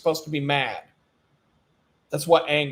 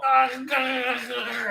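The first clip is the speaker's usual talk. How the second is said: mock gloating cry; 9 semitones higher than usual. Acts Hillary holding baby.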